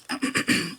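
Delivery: quick dry throat clear. Real, not acted. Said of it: "I've got a tickle".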